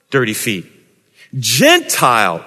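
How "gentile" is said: "Gentile" said slowly and alone, falling at the end; a slow, weighty "Gentile" set apart; stress on Gentile. Shown as a demonstration. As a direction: draw out "Gentile" as the contrast word. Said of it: "emphasis on Gentile slaves".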